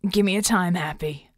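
mild, weary growl. A narrator's reading. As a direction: growl it curtly. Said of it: "he growled".

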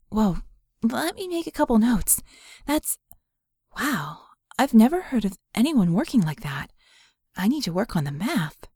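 lightly voiced woman, caught off guard; hint of a woman jotting notes, amazed; lightly voiced Vicky. Vicky speaking, surprised.

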